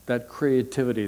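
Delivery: normal talking voice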